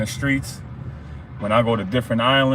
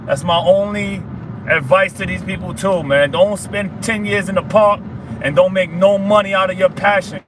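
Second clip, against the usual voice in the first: rising fired-up exhortation; 8 semitones higher than usual. Real, not acted. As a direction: preach it harder with each line. Motivational speech climax.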